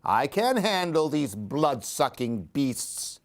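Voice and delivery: usual speaking voice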